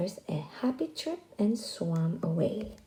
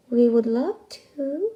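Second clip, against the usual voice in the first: purred; 4 semitones higher, 6 dB louder than usual. Acted. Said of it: "they purred".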